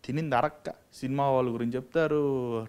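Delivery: normal talking voice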